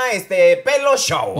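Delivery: hammed-up TV host; announcer voice. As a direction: announce it like a TV emcee. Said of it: "de hoy en adelante esto se llama".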